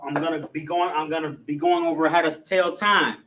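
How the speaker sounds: ordinary delivery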